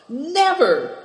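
high, loud cry of refusal; beside himself. A narrator's reading. Tolis refusing Moonlight's orders with a high furious cry. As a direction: burst out in outrage. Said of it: "said Tolis beside himself".

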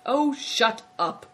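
slow, grumpy brush-off; surly growl. A narrator's reading. Greencoat silencing the chattering innkeeper, surly growl. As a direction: growl it slowly, pitched a little up. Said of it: "growled Greencoat".

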